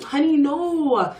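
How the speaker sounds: high, drawn-out imitation of a knowing worker; condescending correction. Spa worker setting naive visitors straight, condescending correction. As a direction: stretch it out, high and patronizing.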